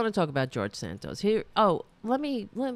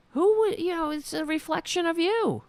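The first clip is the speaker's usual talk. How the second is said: indignant; 12 semitones higher than usual. Real, not acted. Indignant rant at voters.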